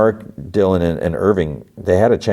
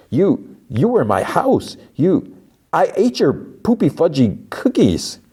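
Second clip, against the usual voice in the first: higher, slower put-on whiny voice; silly mock rant; 10 semitones higher, slower than usual. Mark ranting at her about the cookies, silly whine. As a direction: goof on his anger in a funny voice.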